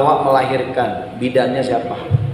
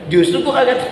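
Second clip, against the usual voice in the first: higher, fast, fed-up retort; exasperated husband; 8 semitones higher, faster than usual. Retold or imitated. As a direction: snap back at the wife, exasperated. (suami kesal membalas istri, nada tinggi dan cepat)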